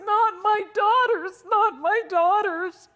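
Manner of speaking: high, anguished cry, half sung; a mother crying out in high despair; retold desperate scream. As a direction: wail it out to the sky in anguish. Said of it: "screaming at the top of my voice".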